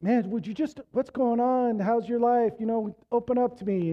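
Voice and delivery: high, coaxing parent voice; imitated pleading parent. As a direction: imitate a parent prodding for an answer. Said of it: "as a parent, we're like".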